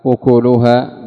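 أكلها said "ukulaha"; damma on the kaf. Shown as a demonstration. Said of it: "بضم الكاف". (أكلها بضم الكاف)

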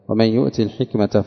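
يؤت الحكمة joined as "yu'ti-l-hikmata"; ya dropped in connection. Shown as a demonstration. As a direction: run short-i يؤت straight into الحكمة. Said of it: "وإذا وصل يحذف الياء". (يؤت بكسر التاء وصلا)

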